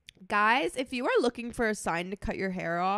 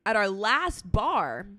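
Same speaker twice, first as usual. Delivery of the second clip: ordinary delivery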